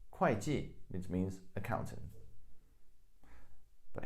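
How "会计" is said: 会计 repeated as "kwai-ji", 会 starting with a k; 会 as kuài. Shown as a demonstration. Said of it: "is pronounced as 会计".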